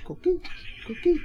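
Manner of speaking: high chirping coquis coquis; coqui frog call. Retold or imitated. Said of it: "That's the noise they make".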